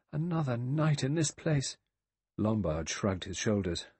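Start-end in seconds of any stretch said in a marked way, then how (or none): 0.1-1.8 mild cry of dismay, pitched up. A narrator's reading. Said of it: "Vera cried".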